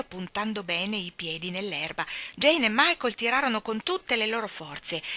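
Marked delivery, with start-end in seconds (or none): none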